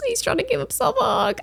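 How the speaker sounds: very high, soft little whimper; tearful squeak. Real, not acted. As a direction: squeeze it out small and high, near tears. Repeats it, close to tears.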